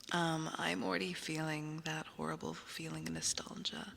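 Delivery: whispered retake of "I'm already feeling". Real, not acted. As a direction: say it again with no voice.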